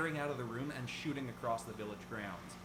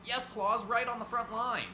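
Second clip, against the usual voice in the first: squeaky voice; 10 semitones higher, 5 dB louder than usual. Acted. Squeaky helper character answers.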